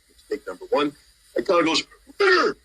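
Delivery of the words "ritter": sharp high bark across the hall; commanding colonel's call; calling out a name. Retold or imitated. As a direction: snap his name out to stop him in place.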